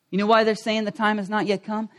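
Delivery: normal talking voice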